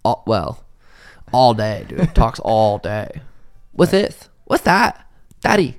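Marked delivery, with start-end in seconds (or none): 3.7-5.8 put-on high little-girl voice, lightly done; toddler voice. Dad imitating his little daughter asking what things are. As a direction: mimic a curious toddler pointing at things.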